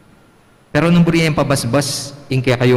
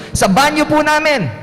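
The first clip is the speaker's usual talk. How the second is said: put-on kid's answer. [name] answers.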